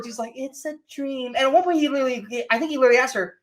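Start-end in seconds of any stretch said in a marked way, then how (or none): 0.3-1.3 much softer, drifting and vacant; mock dazed whisper-soft. Mocking Alice's line, very soft and dazed. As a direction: breathe it out like she's sleepwalking.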